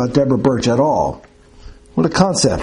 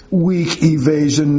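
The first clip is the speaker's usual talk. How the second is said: scornful professor; 4 semitones higher, slower than usual. Acted. Professor scorning nurse's answer.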